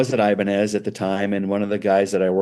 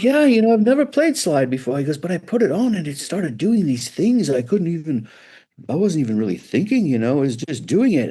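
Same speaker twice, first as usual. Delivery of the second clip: higher, eager storytelling; enthusiastic Paul; 6 semitones higher than usual. Retold or imitated. Paul telling the builder about the slide, lightly enthusiastic. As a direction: marvel at what the instrument did. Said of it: "And he goes like".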